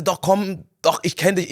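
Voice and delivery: the schoolboy pestering the shop worker, insistent. Retold or imitated. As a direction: imitate a teenager who won't let it go. Schoolboy insists he knows him.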